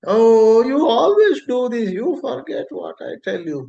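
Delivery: high, loud complaining wife; nagging wife. Retold or imitated. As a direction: whine it like a fond but nagging wife. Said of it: "very lovingly".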